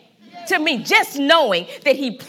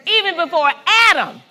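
shouted sermon climax; preacher's shout; 4 semitones higher, 6 dB louder than usual. Real, not acted. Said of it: "slow shouted preaching point".

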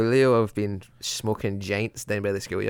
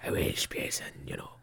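whisper; 9 dB quieter than usual. Real, not acted. Whispered aside about accents.

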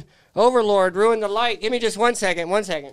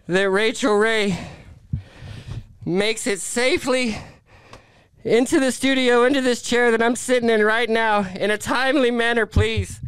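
prayer; 4 dB louder than usual. Acted. Host leads a mock prayer.

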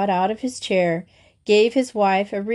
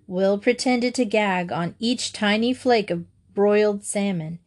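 ordinary delivery, nothing marked

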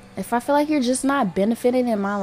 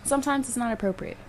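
ordinary delivery

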